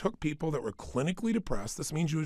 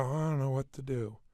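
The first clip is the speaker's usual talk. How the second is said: softer, resigned and limp; defeated murmur; 2 semitones lower, 5 dB quieter than usual. Shown as a demonstration. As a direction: let it sag helplessly. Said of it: "than if you go".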